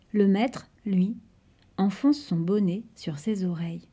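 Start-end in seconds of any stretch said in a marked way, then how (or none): none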